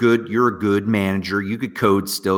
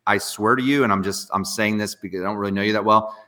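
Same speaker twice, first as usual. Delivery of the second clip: ordinary talk, nothing marked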